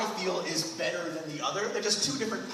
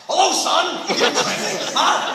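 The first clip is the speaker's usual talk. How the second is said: much higher, louder, laughing hello; a cartoon dad meeting his son, high and loud; hammed-up dad greeting; 9 semitones higher, 11 dB louder, slower than usual. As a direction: boom the greeting through a laugh. Mock dad greeting, shouted.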